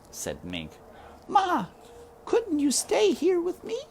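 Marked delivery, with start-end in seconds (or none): 1.3-3.8 put-on very high, small child's voice; high little girl pleading with her mother. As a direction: beg like a frightened child. Child Mig begs dying mother.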